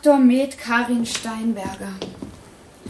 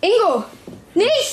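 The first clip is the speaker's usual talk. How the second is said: alarmed cry; 6 semitones higher, slower than usual. Acted. Girlfriend stopping the assault, alarmed cry. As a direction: cry out to stop him. Partner cries out to stop violence.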